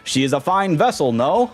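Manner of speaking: high, pompous ship captain voice, stretched vowels; pompous captain Flavio. Acted. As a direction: show off the ship like a vain aristocrat. Voices Flavio reading dialogue.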